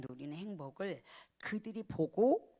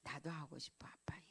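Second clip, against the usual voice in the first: a softer whispered echo of the quote; hushed whisper; 3 semitones lower, 11 dB quieter than usual. Retold or imitated. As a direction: whisper it, voice almost gone. Retelling a moving quote. (감동한 듯 속삭이며)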